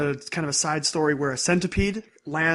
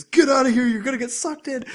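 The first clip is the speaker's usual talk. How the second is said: higher, louder yell shooing someone away; mock shouted order; 8 semitones higher, 4 dB louder than usual. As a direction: yell get out like a protective friend. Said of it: "Usa goes".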